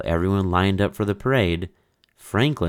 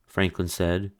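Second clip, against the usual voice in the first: ordinary delivery, nothing marked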